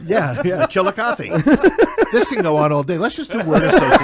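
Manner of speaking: high and loud through laughter; cracking up at the word game; laughing. Real, not acted.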